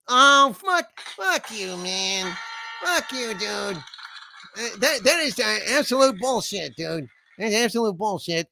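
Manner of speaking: high-pitched indignant cursing; a god lashing out at an insult; offended [name]. Acted. [name] cursing at a viewer's joke about him, high and indignant.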